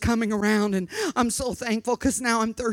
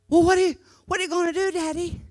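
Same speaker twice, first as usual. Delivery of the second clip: put-on higher, very fast child's question; little girl; 7 semitones higher, much faster than usual. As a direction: rush it out like a worried kid. Child questions her father.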